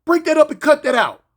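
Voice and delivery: high, loud mock officer order; imitating a guard on the intercom; officer on speaker. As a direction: call it over a jail speaker. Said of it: "come over the speaker thing".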